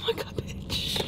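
breathless, slow whispered oh my God; stunned whisper at the cheese pull; shocked whisper. Real, not acted. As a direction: gasp it out under your breath in disbelief.

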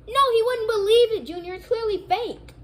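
sibling scolding Junior's fake grade plan; exasperated. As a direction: shoot down a dumb idea. Brother scolds Junior's idea.

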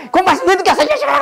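very high, screeched telling-off; mock mom scolding. Mom scolding her late child, mock-furious. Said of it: "엄마의 두 얼굴".

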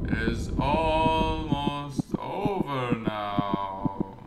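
very slow, stretched-out spooky voice; drawn-out ominous reading. Acted. Gamer reading the game's creepy message, slow ominous voice. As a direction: stretch every word like an omen.

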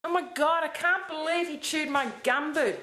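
ordinary talk

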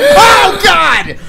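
real startled shout, sudden and loud; startled scream. Real, not acted. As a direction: jump out of your skin and yell. Startled by someone behind him.

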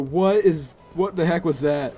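ordinary talk, nothing marked

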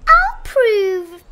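high, loud eager rabbit; boastful rabbit. A narrator's reading. Rabbit accepting the bear's challenge, high and cocky. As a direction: declare it cockily, pitched high.

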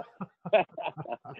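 real high chuckling laugh. Real, not acted. Storyteller amused by the hot credit card, laughing. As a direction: laugh at your own story.

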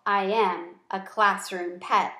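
ordinary delivery

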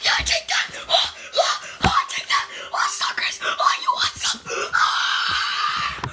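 whispered pretend yelling; hammed-up whispered war cries; mock battle whisper-shout. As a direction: taunt and holler in a whisper. Mock fight as accent joke.

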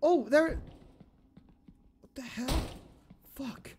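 high, strained scared voice; frightened. Real, not acted. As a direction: plead in fear, loud and high. Monster seen again, frightened.

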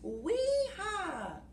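high gleeful wee-haw; monkey whoop. Acted. Monkey whoops while swinging on his vine, high glee.

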